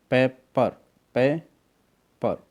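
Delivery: पेपर broken as पे, पर with clear gaps; two separate beats in पेपर; split into syllables. Shown as a demonstration. Teacher showing two syllables, पेपर split in two beats. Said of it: "तो ये two syllable हो गए".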